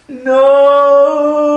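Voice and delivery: high, long-held loud cry; warning shout. Retold or imitated. Boy at the window warning the dog, long high shout. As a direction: yell one drawn-out warning from the window. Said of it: "I'm like".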